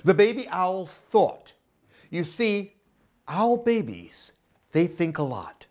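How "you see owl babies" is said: plain reading voice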